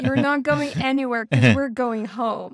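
mock grounding. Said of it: "It's like".

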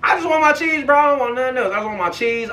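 high-pitched, worked-up insistence; insistent demand. Retold or imitated. Storyteller demanding money back from the scammer, insistent and high. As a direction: keep demanding it, over and over.

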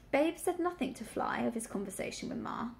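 ordinary delivery, nothing marked

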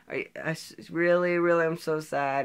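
mock on the verge of tears; nearly crying. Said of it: "sounded like she was gonna cry".

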